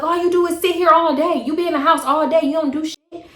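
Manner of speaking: high mocking imitation; high scornful boyfriend. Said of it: "he was like".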